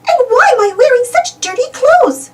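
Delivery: high, puzzled mouse voice; lost Minnie wondering aloud. Acted. Minnie waking somewhere strange, high puzzled voice. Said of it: "she wonders".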